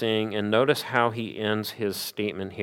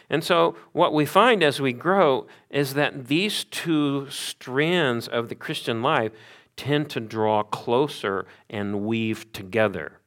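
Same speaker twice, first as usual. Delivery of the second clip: ordinary talk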